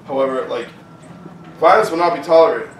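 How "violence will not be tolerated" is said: loud, emphatic warning; forceful declaration. Real, not acted. Repeating the flyer's warning, now loud and hard. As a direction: slam the words down hard.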